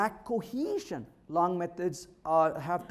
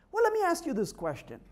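usual speaking voice

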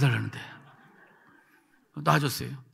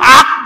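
loud, high mock gag; choked yelp; 5 semitones higher, 15 dB louder, much slower than usual. Boy choking on his forceful prayer, loud yelp. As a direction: break off in a sudden throat-catching cry. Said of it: "목에 걸리지".

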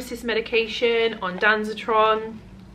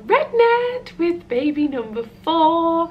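excited; 10 semitones higher, 6 dB louder, slower than usual. Real, not acted. Announcing the pregnancy.

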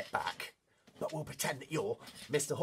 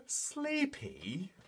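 slow, high yawned-out word; acted sleepy; 4 semitones higher, 5 dB quieter than usual. Leader introduces sleepy horse to children, yawning. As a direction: stretch it out through a yawn.

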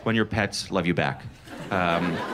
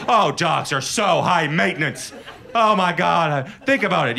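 high, whiny complaining mock voice; mock a snooty cat owner whining; whiny cat person; 6 semitones higher than usual. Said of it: "That's what cat people always say".

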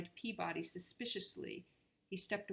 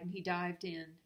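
ordinary delivery, nothing marked

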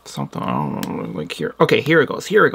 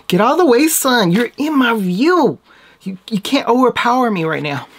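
mock scolding the sun; 5 semitones higher, 3 dB louder than usual. Scolding the sunlight.

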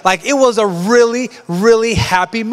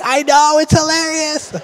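gleeful; 8 semitones higher than usual. Retold or imitated. Retelling gleeful reply to son.

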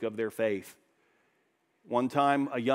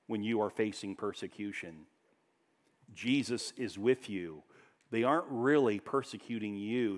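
reassuring; 4 semitones lower, 5 dB quieter than usual. Real, not acted. Comforting the persecuted.

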